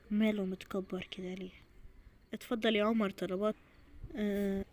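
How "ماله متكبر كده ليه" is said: unvoiced whispered aside. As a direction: mutter it so he won't hear. Aside about the groom. (همس من ورا العريس)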